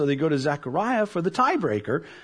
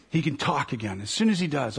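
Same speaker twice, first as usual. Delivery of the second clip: ordinary talk, nothing marked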